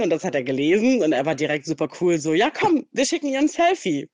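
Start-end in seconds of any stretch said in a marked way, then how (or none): 2.3-4.1 high, upbeat come on; eager suggestion. Retold or imitated. The guy to her in his car, eagerly proposing a selfie. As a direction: pitch it up like he's all in.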